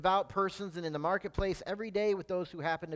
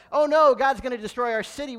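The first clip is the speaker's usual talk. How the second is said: loud, higher, hammed-up panic; acted sudden dismay, raised voice; mock alarm; 5 semitones higher, 8 dB louder, faster than usual. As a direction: cry out like an alarmed townsperson. Ninevites react in alarm to warning.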